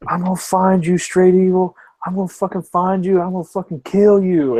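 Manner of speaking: imitating a furious marine threatening revenge; mock threat. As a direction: rage at him like an angry gamer.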